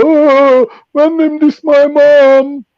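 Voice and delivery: mock whiny complainer. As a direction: parody a crybaby moaning about his mum. Mocking whiners who harp on.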